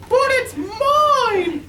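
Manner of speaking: very high, slow, loud bird shriek; screaming Maisie. A narrator's reading. Maisie claims the hatching egg, slow high loud screech. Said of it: "screamed the bird".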